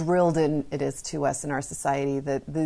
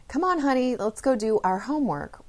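put-on high, singsong cajoling of a child; mock-sweet parent coaxing, pitched up; 8 semitones higher than usual. Shown as a demonstration. Parent to child about our homework, coaxing. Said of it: "Well, that's incorrect".

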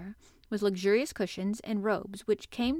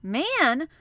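higher, louder startled echo; startled cry; 5 semitones higher, 7 dB louder than usual. A narrator's reading. Two children echoing Colin's alarm, startled cry. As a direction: cry it out in surprise. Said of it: "they both cried in low, quick voices".

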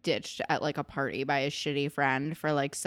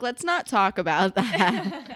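slow, amused mock dodge; slowed, giggly imitation; laughing through it; 3 semitones higher, 5 dB louder, slower than usual. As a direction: laugh while changing the subject. Emma voiced, laughing.

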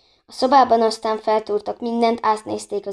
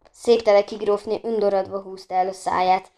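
ordinary delivery, nothing marked

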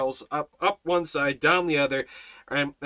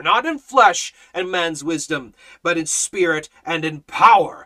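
loud, higher-pitched sermon delivery; full-force preaching; 4 semitones higher, 8 dB louder than usual. Real, not acted. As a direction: preach it hard, hammering each phrase.

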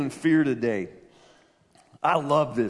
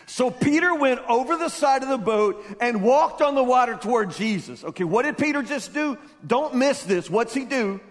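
impassioned preaching; 8 semitones higher, 5 dB louder than usual. Acted. Preacher builds Peter's walk with urgency.

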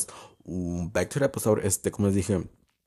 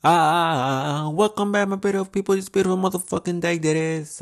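big bright sing-song hello, much higher; excited high greeting; 10 semitones higher, 5 dB louder than usual. Real, not acted. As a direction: greet the audience with a high, showy welcome. Opens episode greeting listeners.

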